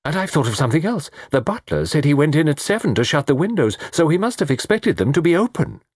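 high, eager gentleman's voice; keen, animated amateur sleuth; excited Mister Satterthwaite. Acted. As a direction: rush in with a new deduction. Satterthwaite excited by new deduction.

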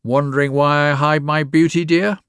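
put-on old woman taunting the lawyer about her face; mocking crone. A narrator's reading.

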